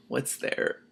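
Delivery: unvoiced whisper; suspense whisper. Real, not acted. Reader peering ahead in the story, whispering. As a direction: breathe it in suspense.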